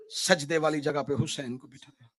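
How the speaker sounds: usual speaking voice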